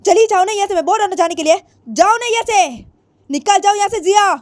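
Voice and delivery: loud, high shout telling her to leave; angry father. Acted. Father ordering Jiya out of his house, loud and angry. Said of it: "जिया के बाप बोलती है".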